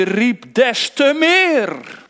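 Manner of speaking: loud, slow call of "meer"; crying out. Acted. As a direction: draw out "des te meer" loudly. Preacher enacting Bartimaeus crying. (luid en langzaam uitgeroepen)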